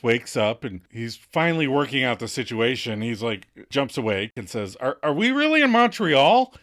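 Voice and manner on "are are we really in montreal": startled. Retold or imitated. Ross jumps awake.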